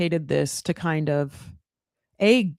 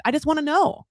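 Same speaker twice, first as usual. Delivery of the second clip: usual speaking voice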